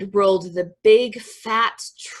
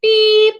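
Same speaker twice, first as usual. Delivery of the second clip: high, loud toy horn beep; one held, high-pitched beep; 13 semitones higher, 11 dB louder than usual. A narrator's reading. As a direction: beep like a cheerful little truck horn. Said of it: "said Blue to a big green toad".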